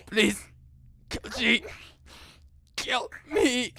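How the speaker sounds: high, strained inhuman plea; inhuman pleading voice. Acted. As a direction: beg like a dying monster. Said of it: "not a human voice".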